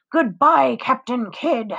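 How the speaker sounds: mocking taunt. A narrator's reading. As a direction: tease him with a jeering farewell. Frankie's mocking farewell taunt.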